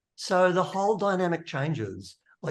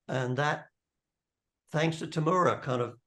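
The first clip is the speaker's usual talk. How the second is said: ordinary talk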